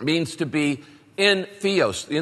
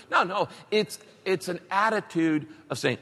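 ordinary delivery

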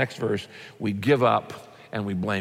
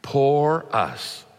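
drawn-out, mock pity-party moan; self-pitying. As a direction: wallow in it, drawing out the words. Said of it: "They had a giant pity party".

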